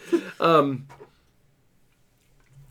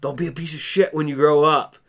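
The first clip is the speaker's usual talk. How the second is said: stern mock warning; 5 semitones higher, 7 dB louder than usual. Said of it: "I'm gonna grab people before".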